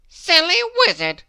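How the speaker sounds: high, loud scornful exclamation; scornful Mischief voice. A narrator's reading. Mister Mischief scoffing in his kitchen, high and loud. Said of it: "he said again".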